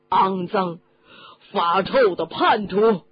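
loud, indignant curse; loud outburst. Acted. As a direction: spit out the insult for the whole pub to hear. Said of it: "声音很响". (大声怒骂)

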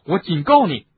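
loud threatening warning; angry warning. Acted. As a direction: warn him, loud and hard. Harry threatens Kreacher.

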